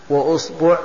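"وأصبع" said as wa-usbu', u in both syllables; opening u, middle u. Shown as a demonstration. Teacher lists variants for students, "وأصبع" as wa-usbu'. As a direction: say "وأصبع" with damma on hamza and ba. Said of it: "ضم الهمزة".

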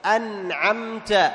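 "أنعمت" very slow with the nun and the mim each clearly sounded. Shown as a demonstration. Teacher modelling clear nun and mim for the class, slow. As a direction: draw out "أنعمت" and sound the nun before the ain. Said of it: "تظهر النون".

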